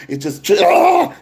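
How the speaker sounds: sudden high preacher yell bursting out; Hulk roar. Real, not acted. Preacher telling the congregation it comes out like the Hulk, roaring yell. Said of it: "but it come out like the Hulk".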